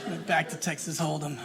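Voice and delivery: low, soft repeat to herself; muttered aside. Acted.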